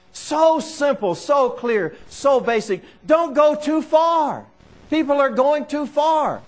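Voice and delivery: slow, emphatic pulpit exhortation; insistent sermon warning; forceful preaching. Real, not acted.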